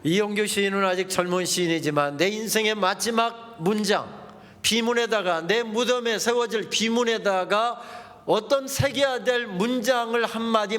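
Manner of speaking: usual speaking voice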